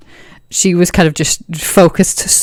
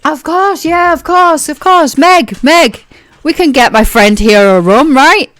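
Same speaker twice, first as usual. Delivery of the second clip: higher, keen, hearty; eager Ro; 9 semitones higher, 3 dB louder than usual. Acted. Ro eagerly asks Meg to pour her friend a rum.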